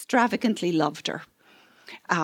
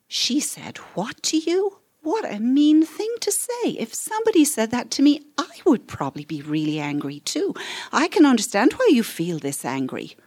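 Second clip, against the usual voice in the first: validating demo; 6 semitones higher than usual. Shown as a demonstration. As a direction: sound shocked on the child's behalf, then understanding. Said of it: "So that sounds like this".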